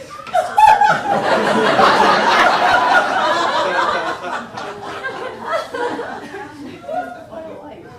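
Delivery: high, loud, helpless laughter; long loud laugh. Real, not acted.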